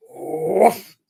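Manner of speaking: one sudden rising woof; imitated cat woof. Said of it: "And you hear".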